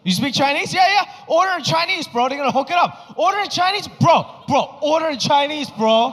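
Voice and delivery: high, loud, pestering hype; pushy excited friends. Retold or imitated. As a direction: egg him on like an eager bro. Friends pestering him loudly.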